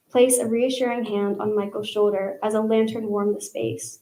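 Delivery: ordinary talk, nothing marked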